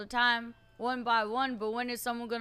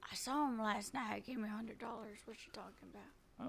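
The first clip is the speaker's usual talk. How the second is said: very soft, secretive undertone; hushed; 3 semitones lower, 13 dB quieter than usual. Acted. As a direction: drop your voice right down. Hushed talk after whispering remark.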